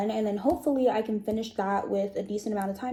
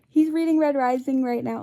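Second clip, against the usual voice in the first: wearing a retainer; 3 semitones higher, 6 dB louder than usual. Real, not acted. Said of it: "I have my retainers in".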